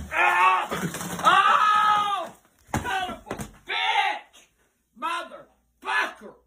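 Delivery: put-on shrieking old granny, enraged; furious granny shout. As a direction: yell in shock as an angry grandma. Granny shocked by exploding Coke.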